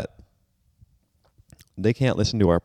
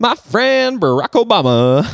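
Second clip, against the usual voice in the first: slow, high hammed-up politician impression; Joe Biden; 11 semitones higher, 4 dB louder, slower than usual. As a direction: draw it out, pitched way up. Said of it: "an excellent Joe Biden".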